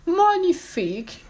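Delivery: high, drawn-out exclamation; acted grand delight, slow and high; self-admiring. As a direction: revel in it, stretching the word. Fifi admiring her cut dress.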